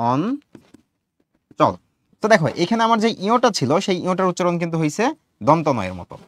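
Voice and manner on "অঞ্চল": অঞ্চল very slowly in two parts, ঞ as a plain n; pronunciation demo. Shown as a demonstration. Teacher showing how ঞ is pronounced, অঞ্চল drawn out. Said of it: "এটার উচ্চারণ হয় এরকম".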